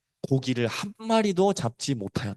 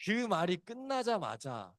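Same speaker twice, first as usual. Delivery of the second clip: slow, much softer hush; hushed suspense; 12 dB quieter, slower than usual. Shown as a demonstration. Preacher hushing the church before the rooster crows, slow and soft. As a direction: drop to a near hush to hold the room. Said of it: "dramatic hush after long pause".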